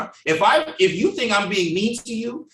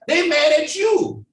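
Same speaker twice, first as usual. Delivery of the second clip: exasperated; 10 semitones higher, 5 dB louder than usual. Real, not acted. Mocking how people react.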